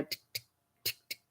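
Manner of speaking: imitated blinker ticks, tiny dry clicks; soft, sparse clicking like a faulty blinker; malfunctioning blinker. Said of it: "sort of like a malfunctioning blinker".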